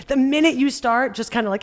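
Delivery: normal talking voice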